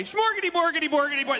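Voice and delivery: high, sing-song mock Swedish gibberish; Swedish Chef. Comic imitates a Muppet Show puppet, mock Swedish gibberish. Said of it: "was the Swedish Chef okay".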